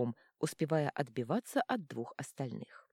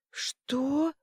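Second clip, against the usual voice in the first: much higher, strained wheeze; wheezing serpent; 10 semitones higher, 4 dB louder than usual. A narrator's reading. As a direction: rasp it out like a beaten dragon. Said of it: "просипел змей". (сиплый писк змея)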